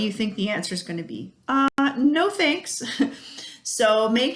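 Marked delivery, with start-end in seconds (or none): 1.5-3.1 put-on child's reply. Happy child declining, quoted.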